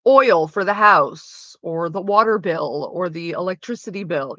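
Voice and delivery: usual speaking voice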